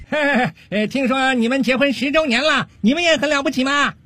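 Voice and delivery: high, cheerful call across to the other boat; friendly call. Acted. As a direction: shout back in a friendly way. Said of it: "很友好的朝那边喊道".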